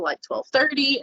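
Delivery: ordinary delivery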